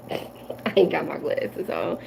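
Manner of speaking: real soft laugh through the words; laughing softly. Real, not acted. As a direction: laugh at yourself under your breath.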